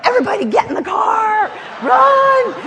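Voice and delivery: high, loud shouted order; frantic shout. Retold or imitated. Advance staffer ordering the motorcade to race over, frantic shout. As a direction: yell everyone into the cars. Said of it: "I was like".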